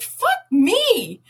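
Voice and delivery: high pained swear; shocked curse. Retold or imitated. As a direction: curse as the jolt lands. Shocked again, cursing.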